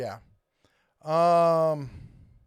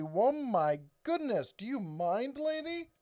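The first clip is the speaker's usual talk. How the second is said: rising exasperation, high on lady; hammed-up annoyed city pedestrian; mock indignant; 9 semitones higher, slower than usual. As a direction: play at being bumped by a rude stranger.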